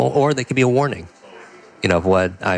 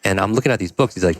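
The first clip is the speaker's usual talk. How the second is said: normal talking voice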